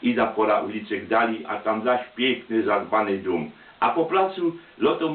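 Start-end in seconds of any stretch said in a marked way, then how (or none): none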